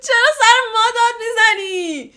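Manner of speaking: ordinary talk, nothing marked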